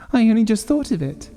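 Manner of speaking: very high, breathless hysteria; half laughing, high frantic; hysterical. Acted. Wife babbles to husband, high and hysterical. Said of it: "she said hysterically".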